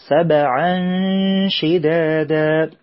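سبعا with the ba bounced out clearly; qalqala on ba. Shown as a demonstration. Teacher shows the children the qalqala on the ba. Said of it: "إظهار القلقلة في الباء".

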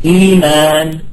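"إيمان" drawn out with a long "ee"; smooth eee-maan for "إيمان", no inner stop; hamza replaced. Shown as a demonstration. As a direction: hold both vowels of "إيمان" on an even pitch. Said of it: "أبدلوها".

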